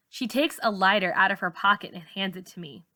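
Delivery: plain reading voice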